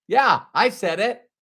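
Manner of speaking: usual speaking voice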